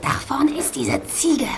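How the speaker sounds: slow, hushed whisper. Acted. Gabi whispering to friends about the rude woman, hushed. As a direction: whisper so she won't hear.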